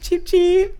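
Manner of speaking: very high squeaky chick peeps; baby chick peeps. Pretend. Joins chicken teasing.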